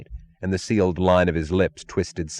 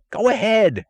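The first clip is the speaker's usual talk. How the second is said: high, loud eager cry; 5 dB louder than usual. A narrator's reading. Taggart urges the torturers on, high and eager. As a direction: urge them on, hungry for more. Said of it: "cried Taggart".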